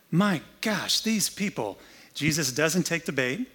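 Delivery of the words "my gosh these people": exasperated groan. Acted. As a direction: throw up your hands at "these people".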